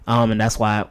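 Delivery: usual speaking voice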